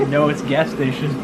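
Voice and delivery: words pushed out through a laugh. Real, not acted. Laughing as he tells his friend his outfit is gas station. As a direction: answer while still laughing.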